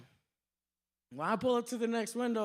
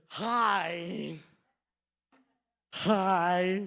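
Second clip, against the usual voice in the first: low, drawn-out mock braces voice; headgear-wearing cashier; 3 semitones lower, 4 dB louder than usual. Cashier opening the window, mock headgear voice. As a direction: imitate a greeting through headgear. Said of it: "She had this whole headgear".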